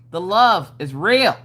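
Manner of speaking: normal talking voice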